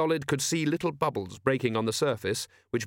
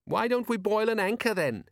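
high, cheeky son's voice; sarcastic suggestion, pitched up; 10 semitones higher than usual. A narrator's reading. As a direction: answer Dad back, high and pert. Son's cheeky retort to father.

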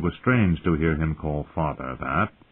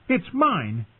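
put-on high defiant boy voice; fierce child; 12 semitones higher than usual. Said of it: "Bran cried out fiercely".

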